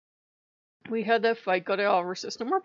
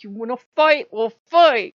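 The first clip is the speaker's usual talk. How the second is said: loud, punchy mock dare; retold angry challenge; 3 semitones higher, 11 dB louder than usual. Speaker so mad she challenges a god, acted belligerence. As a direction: re-enact squaring up for a fight. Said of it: "When I am that mad".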